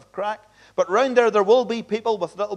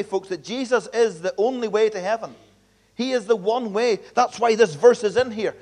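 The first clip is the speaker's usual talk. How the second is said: ordinary talk, nothing marked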